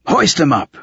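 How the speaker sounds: high-pitched, urgent command; ordering. A narrator's reading. Old wizard ordering them to lift Silent, high urgent command. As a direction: give a sharp order, pitched well up. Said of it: "the old wizard ordered".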